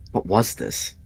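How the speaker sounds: usual speaking voice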